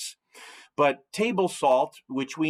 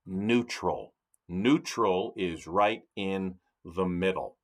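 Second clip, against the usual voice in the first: "neutral" set apart by a pause, lower in pitch; emphasis on "neutral"; 6 semitones lower, 3 dB quieter than usual. Real, not acted. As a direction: land "neutral" deliberately, low, then repeat it. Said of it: "I'm emphasizing that word neutral".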